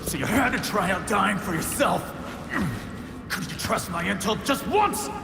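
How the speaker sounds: high-pitched, strained outburst; exasperated reproach. Acted. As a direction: scold a friend while struggling, voice pushed high. Rescuer angry at poisoned friend.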